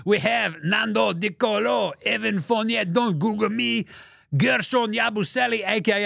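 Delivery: a teasing hint of a French accent reading the names; mock French accent.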